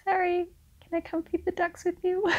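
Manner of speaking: high, soft sheepish voice asking timidly; meek plea. Retold or imitated. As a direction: play him as a sweet puppy begging to tag along. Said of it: "And he's like".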